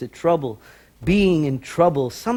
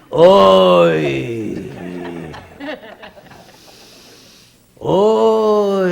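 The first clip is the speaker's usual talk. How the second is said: long heavy groaning oy; imitated sigh; 2 semitones higher, 5 dB louder than usual. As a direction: sigh out oy like a weary grandfather. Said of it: "these big krechts".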